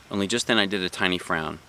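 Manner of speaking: ordinary delivery, nothing marked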